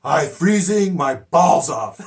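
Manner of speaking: loud, slow imitation of a learner's accent; Amigo's learner accent. Student proudly greets female guard, loud learner's accent. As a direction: belt it out in a careful foreign learner's accent. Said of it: "and he goes".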